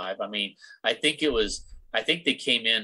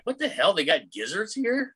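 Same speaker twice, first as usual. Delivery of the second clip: amazed delight; 9 semitones higher than usual. Retold or imitated. Customer spotting gizzards on the menu, amazed. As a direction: squeal it in mock disbelief.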